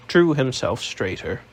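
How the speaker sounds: plain reading voice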